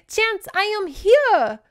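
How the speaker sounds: high, slow hammed-up heroic declaration; mock hero line. Bandit hero announcing himself, hammed-up. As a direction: announce yourself like a swashbuckler.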